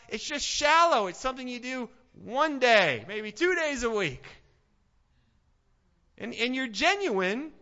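loud, high fiery sermon delivery; preaching at full force. Acted. Preacher rouses the congregation with loud fiery preaching. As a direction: drive it home to the back row.

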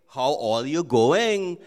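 slow, sing-song Trini lilt; Trinidadian greeting. Shown as a demonstration. Pastor showing how Trinis greet, slow Trinidadian lilt. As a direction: let the Trini tune carry it slowly. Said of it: "In Trinidad, they say".

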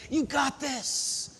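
soft, slow, close in the ear; gentle whisper. Acted. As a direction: breathe it like comfort. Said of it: "he wants to whisper in your ear".

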